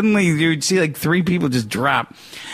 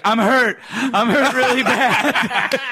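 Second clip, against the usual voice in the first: mock dazed groan; 7 semitones higher, slower than usual. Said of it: "he just slumps over and he's like".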